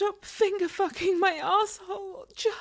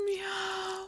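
meow; 5 dB quieter than usual. Acted. Catgirl roleplay, playful meow.

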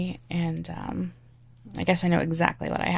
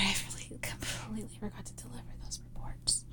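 whispered apology; 14 dB quieter, faster than usual. Acted.

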